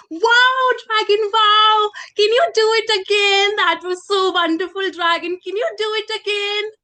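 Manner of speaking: high, loud wide-eyed awe; excited begging. Acted. Princess begging the dragon for a repeat, excited. Child marvels at dragon in story.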